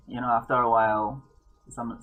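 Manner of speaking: normal talking voice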